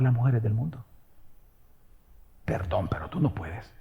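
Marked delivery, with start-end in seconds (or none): 2.4-3.7 breathy whisper, much softer, confiding; whispered aside. Real, not acted. Preacher to the married men, a whispered aside. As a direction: lean in and whisper it like a secret.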